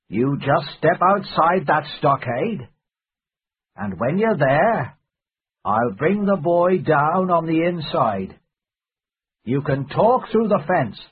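Silver giving instructions. A narrator's reading.

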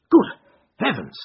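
narrated exclamation of surprise; astonished cry. A narrator's reading. Watson discovers his friend in disguise, mildly astonished cry. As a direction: exclaim with a touch of shock. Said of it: "I cried".

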